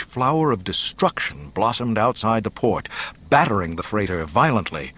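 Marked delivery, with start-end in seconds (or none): none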